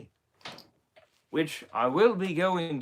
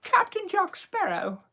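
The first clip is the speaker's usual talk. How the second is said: slow, very high falsetto lady; mock-feminine high voice, drawn out; Elizabeth Swann; 4 dB louder than usual. Shown as a demonstration. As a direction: call the name in a put-on woman's falsetto. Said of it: "That would be my Elizabeth impression".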